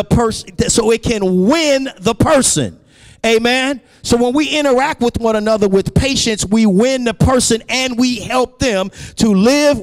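high-pitched, insistent sermon cadence; emphatic preaching. Real, not acted. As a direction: press the congregation with sustained high energy. Preacher drives the point home.

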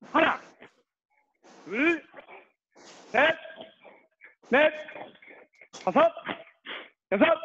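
drill count. Real, not acted. Instructor counting arm-hug reps for the class, drill count. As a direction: call each rep as its own beat.

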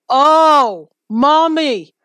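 loud, high terrified cry; scared horror game streamer. Real, not acted. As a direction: cry out for help in terror. Terrified cry while chased.

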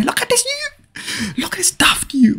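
high, breathless mocking whisper; whispering at a player who runs away. Real, not acted. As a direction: sneer at him under the breath.

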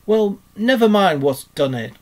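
high, loud pained complaint. A narrator's reading. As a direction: brush it off crossly through pain. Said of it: "forgetting his grammar in his pain".

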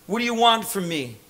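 ordinary delivery

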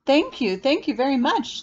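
high, loud delighted thanks; bubbly thanks. Acted. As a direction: gush with bubbly gratitude. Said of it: "bubbled playfully".

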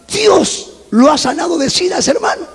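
high, astonished questioning; asombro incrédulo. Retold or imitated. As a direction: echo it back in disbelief, pitch leaping up. Retold astonished question back to pastor. (pregunta incrédula, en tono agudo)

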